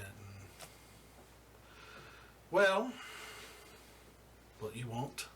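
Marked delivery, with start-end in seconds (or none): 2.5-5.3 high, louder, slow and smug self-introduction; smug crime boss. Acted. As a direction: introduce yourself like you own the room. Boss demands their business.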